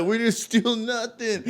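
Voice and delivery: high, drawn-out mock denial through laughter; protesting friends. Accused friends answering the storyteller, laughing mock protest.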